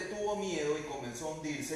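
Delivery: ordinary talk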